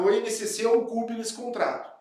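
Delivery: ordinary talk, nothing marked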